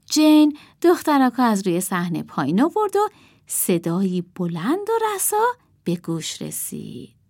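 plain reading voice

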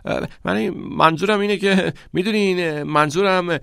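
high, halting, tongue-tied stammer; flustered stutter, searching for words. A narrator's reading. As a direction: stumble over the words after a glare. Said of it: "به پت و پت افتاد".

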